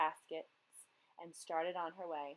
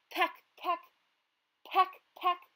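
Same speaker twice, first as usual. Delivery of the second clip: pecking sounds; 10 semitones higher, 6 dB louder, slower than usual. A narrator's reading.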